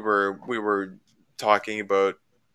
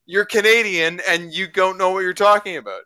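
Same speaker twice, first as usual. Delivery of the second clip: higher, louder, scornful brush-off; imitating the opponent shutting him down; mock curt dismissal; 8 semitones higher, 5 dB louder than usual. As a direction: slap the point down with contempt. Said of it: "And he was like".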